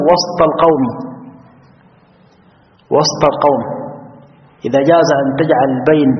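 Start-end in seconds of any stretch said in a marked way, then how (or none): 0.1-3.6 وسط as wasTa, no vowel after s; sukun on the seen. Shown as a demonstration. Teacher giving an example, unvowelled seen in وسط. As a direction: keep the seen of وسط closed before القوم. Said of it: "فإن سين تسكن".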